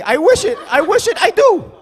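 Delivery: high, rising mock vehemence; emphatic insistence. Insists on outrageous wish.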